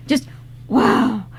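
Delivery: ordinary delivery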